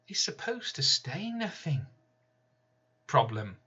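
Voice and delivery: ordinary delivery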